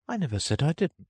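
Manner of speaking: put-on high, girlish protest; Alice voice. A narrator's reading. Alice interrupting the Mock Turtle, high and protesting. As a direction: cut in with a high child's voice.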